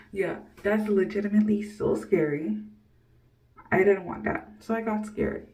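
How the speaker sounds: ordinary talk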